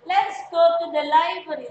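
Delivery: "library" said loud as "lai-bə-ri"; loud model word. Shown as a demonstration. Teacher loudly models "library" for students. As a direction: call out "library" clearly for the class. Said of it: "Not library, tetapi library".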